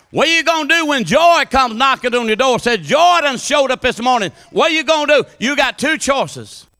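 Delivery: loud, high preacher's call; preaching at full force. Acted. Preacher challenging the congregation, loud and high. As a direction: drive the question home to the congregation.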